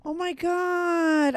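slow shocked oh my God; stunned. Real, not acted. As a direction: let the news land, slow and high. Host hears friend died.

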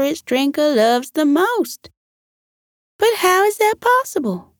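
high, thoughtful, rising to wonder; puzzled Missus Pig. Acted. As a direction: work it out aloud, amazed at the end.

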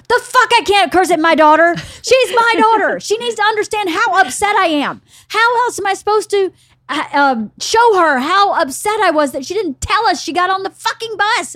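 high, loud outraged comeback; indignant retort. Retold or imitated. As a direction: imitate him defending his cursing. Retelling husband's angry retort.

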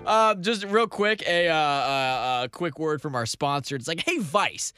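mock ad read. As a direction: sell it like a cheesy radio ad.

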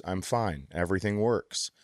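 usual speaking voice

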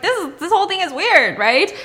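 high, wary questioning voice; suspicious caretaker. Retold or imitated. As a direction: probe whether something's off. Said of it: "And she's like".

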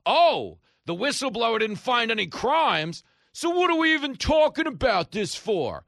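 very high, loud indignant squawk; exaggerated righteous protest; mock self-righteous. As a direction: shout it like an outraged pundit, hammed up. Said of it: "in a self-righteous manner".

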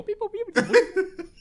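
very high imitated robot beeping; playful high-pitched robot noises; droid beeps. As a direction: squeak out droid bleeps, close to laughing. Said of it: "where you're a Spanish droid".

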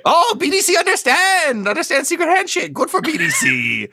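squeaky little doorman demanding the password. Acted. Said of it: "a little voice says".

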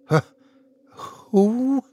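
high, halting stammer. A narrator's reading. Husband stammering at the sight of the hair, shaken. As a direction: stumble over the words in shock. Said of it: "I stammered".

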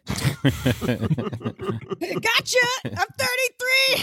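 high-pitched gleeful squeal; spring the trap in a high voice; hammed-up, gloating reveal; triumphant gotcha. Acts out catching a predator.

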